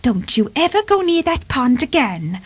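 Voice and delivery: admonishing mother cat; cautioning. Acted. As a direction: caution a naughty kitten firmly. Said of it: "she cautioned".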